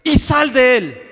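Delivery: emphatic preacher's reenactment of an exorcism command; stern rebuke. Retold or imitated. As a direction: act out a firm, ringing order. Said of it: "Pero Jesús le dijo". (reprensión firme, imitada)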